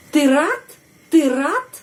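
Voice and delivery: high question with рад swooping upward; question intonation on рад. Shown as a demonstration. Teacher demonstrating a question by voice alone, stressed rising intonation. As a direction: stress рад and lift it sharply to ask. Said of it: "Penekanan intonasi kita di sini pada kata рад".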